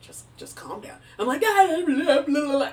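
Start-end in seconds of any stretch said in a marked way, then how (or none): 0.0-1.0 soft, soothing imitated father; calming dad. Father calming a panicked child by phone, hushed reassurance. 1.4-2.7 high, loud blubbering; mock crying. Crying to dad on the phone, exaggerated blubbering. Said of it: "like, cry".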